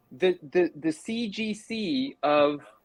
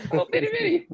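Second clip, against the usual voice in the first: high giggly tiny voice; squeaky laughing voice; faster than usual. Pretend.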